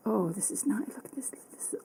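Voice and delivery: hushed whisper. Real, not acted. Testing a new colour, hushed whisper. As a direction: breathe it out, fascinated.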